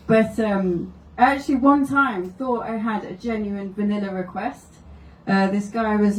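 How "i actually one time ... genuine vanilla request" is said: ordinary delivery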